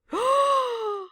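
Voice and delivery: loud, high gasp; shocked gasp. Real, not acted. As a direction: gasp in sudden dismay. Realizes a mistake in the game.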